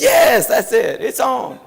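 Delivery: high, loud, delighted yell as the friend; imitating a friend's overjoyed cry; excited shout. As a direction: shout it out, thrilled he's finally understood. Friend finally gets understood, excited.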